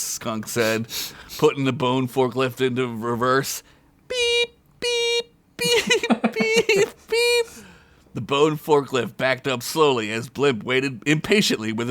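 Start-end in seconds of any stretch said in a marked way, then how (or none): 4.1-7.5 high electronic beeping; high steady beep noises; backup beeps. Acted. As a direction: imitate a forklift reversing. Mock reverse alarm.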